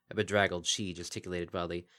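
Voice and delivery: ordinary delivery, nothing marked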